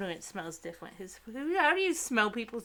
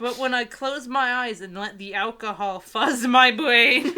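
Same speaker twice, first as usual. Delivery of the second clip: louder read, breaking into laughter; laughing; 4 semitones higher, 12 dB louder than usual. Acted. To her co-host, rereading the drinking line while laughing. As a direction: read it through giggles at the absurd line.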